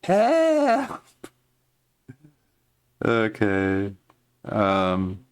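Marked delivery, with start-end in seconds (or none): 0.0-1.0 high goblin yelp; goblin cry for help. Acted. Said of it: "Just a mad, angry goblin".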